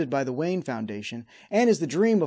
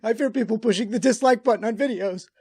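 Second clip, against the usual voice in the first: high, mock-panicked pleading; hammed-up terror; 7 semitones higher, 7 dB louder than usual. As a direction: build from fearful halting to loud, high panic. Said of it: "spraying us with his fear gas".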